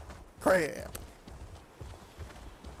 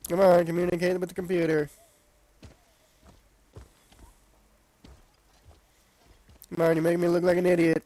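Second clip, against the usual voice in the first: impatient; faster than usual. Real, not acted. Pleading with controller.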